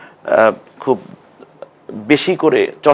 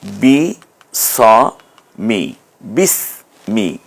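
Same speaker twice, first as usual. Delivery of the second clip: বি, স, মি split very slowly then joined; broken into syllables; much slower than usual. Shown as a demonstration. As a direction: separate each syllable, then blend. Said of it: "আমরা যে বিসমি বলি".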